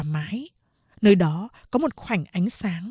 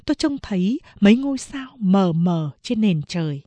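ordinary talk, nothing marked

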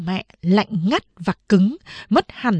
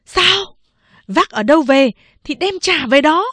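sharp, louder angry retort; furious; 8 semitones higher, 4 dB louder than usual. Acted. Mother furiously ordering daughter to return the baby. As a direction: snap back in rage. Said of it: "nổi điên lên".

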